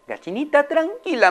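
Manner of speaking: high, coaxing calm-down; soothing. Acted. Owner calms her screeching hen, high and coaxing. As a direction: settle the hen down gently. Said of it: "le dijo".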